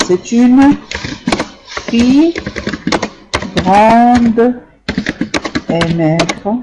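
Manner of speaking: word by word, with long pauses; slow dictation. Shown as a demonstration. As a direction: dictate each word slowly to the class. Teacher dictating the corrected sentence. (dictée lente, mot par mot)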